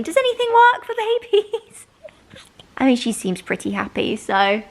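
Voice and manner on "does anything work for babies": much higher, half-crying plea; mock despair. Real, not acted. Tired mum begging viewers for baby sleep tips, in a mock-crying wail.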